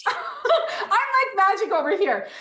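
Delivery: giggling through the words; laughing. Real, not acted. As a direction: laugh while boasting playfully. Laughs at own trick.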